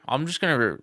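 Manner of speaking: ordinary talk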